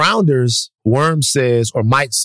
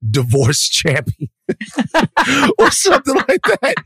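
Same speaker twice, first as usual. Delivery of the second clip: laughing; 10 semitones higher than usual. Real, not acted. Laughing at own joke.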